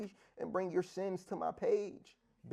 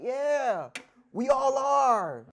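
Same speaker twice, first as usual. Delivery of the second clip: drawn out admission; 6 semitones higher, 7 dB louder, much slower than usual. Real, not acted. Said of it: "admits hypocrisy".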